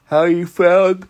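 words stretched through a yawn. Real, not acted. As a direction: talk right through a big yawn. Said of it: "I'm sorry for yawning".

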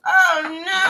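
high loud mock dismay; dramatic oh no. Real, not acted. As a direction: squeal it in mock panic. Reacting to going first.

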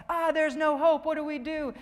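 high, loud cartoon despair; mock panic. Pastor acting out Larry Boy being eaten, in mock panic. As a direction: cry out in hammed-up panic. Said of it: "and he's like".

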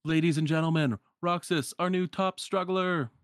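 high, projected announcer voice; showy ring-announcer call; event announcer. Acted. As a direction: announce the new champion to the crowd. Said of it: "And they answer".

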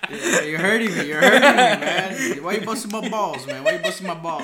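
words broken up by real laughter; laughing while talking. Real, not acted. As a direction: laugh through the protest. Laughing at friend's teasing jab.

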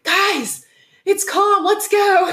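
high-pitched mock call-out; imitated yell. Mom yells from the other tent, imitated high yell. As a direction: call everyone up like an eager mom. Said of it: "her mom yells from the other tent".

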